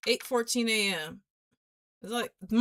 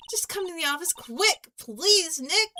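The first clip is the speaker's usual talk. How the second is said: higher and urgent; pleading girl; 6 semitones higher than usual. Acted. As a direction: beg him to hurry. Maya pleading.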